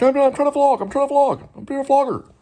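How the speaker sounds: high-pitched mock protest to his wife; mock whiny protest. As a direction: whine it like a kid defending himself. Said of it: "And I'm like".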